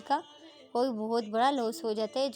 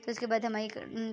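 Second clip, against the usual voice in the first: ordinary delivery, nothing marked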